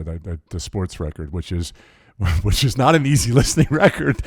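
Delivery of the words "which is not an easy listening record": laughing through it, louder and higher. Real, not acted. Guest jokes about his sports record, laughing. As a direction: chuckle at your own joke while saying it.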